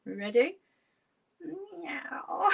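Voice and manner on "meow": high rising meow; playful cat meow. Pretend. Laughter yoga teacher leading cat stretches, high playful meow. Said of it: "pretend to be a cat".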